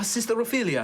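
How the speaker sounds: put-on high-pitched greeting in a light European accent; slight European accent. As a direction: redo the name with a hint of European accent. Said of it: "European accent".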